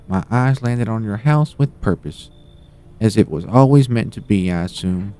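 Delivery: put-on high old lady voice. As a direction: play a sweet meddling granny.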